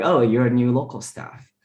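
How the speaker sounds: usual speaking voice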